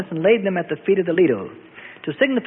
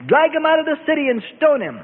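high screamed order to a mob; Caiaphas screaming; 8 semitones higher than usual. A narrator's reading. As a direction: cry out the command, voice pushed high. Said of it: "screamed Caiaphas".

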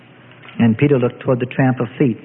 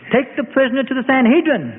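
high-pitched command; ordering; 9 semitones higher than usual. A narrator's reading. Caiaphas ending the beating, ordering. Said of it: "he ordered".

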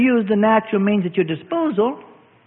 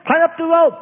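yelled call; 11 semitones higher, 4 dB louder than usual. Acted. Helicopter crew yelling down.

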